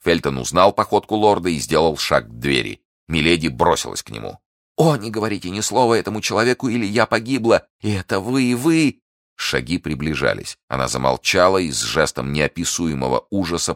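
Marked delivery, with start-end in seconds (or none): 4.8-9.0 high, urgent plea, rising; frantic Milady. Acted. Prisoner begging the guard as footsteps approach, high and frantic. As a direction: beg at a high pitch, climbing at the end.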